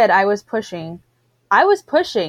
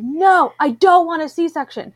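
higher, retold angry protest; mad refusal; 8 semitones higher than usual. Mother refusing the emergency C-section, with a retold angry protest. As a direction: push back hard against the doctors, higher and angry. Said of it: "I was so mad".

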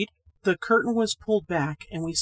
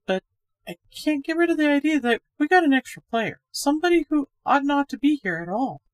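higher, brighter-pitched woman, chatty and a little uneasy; Miss Gorman's voice; 8 semitones higher, 4 dB louder than usual. A narrator's reading. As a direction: play the friendly young woman, higher and louder.

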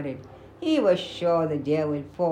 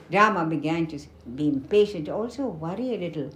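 ordinary delivery

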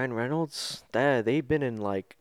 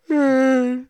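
mock star greeting; 8 semitones higher, 5 dB louder, much slower than usual. Acting a movie star calling out.

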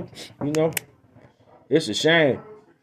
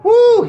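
excited whoop; 6 dB louder than usual. Retold or imitated. Cheering the robbery.

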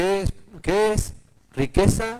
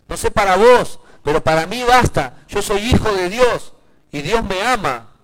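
raised, emphatic preacher's declaration; full-force preaching; 3 semitones higher, 5 dB louder than usual. Real, not acted. Preacher to the congregation on being God's children, full-force preaching. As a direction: preach it out, higher and louder.

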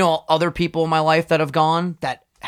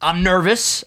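normal talking voice

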